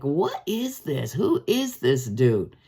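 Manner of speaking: a hint of frightened bystanders puzzling over a stranger; terrified onlookers. Preacher voicing amazed crowd, slow mild bewilderment. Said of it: "are terrified".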